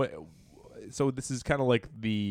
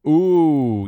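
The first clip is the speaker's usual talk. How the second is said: impressed ooh; 6 semitones higher, 13 dB louder than usual. Real, not acted. Reacting to the quoted passage.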